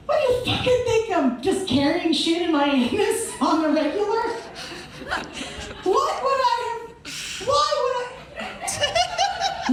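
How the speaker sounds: high, incredulous squeal at the strip search; mock indignant rant. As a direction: act outraged that anyone would hide things there. Ranting at the intake search.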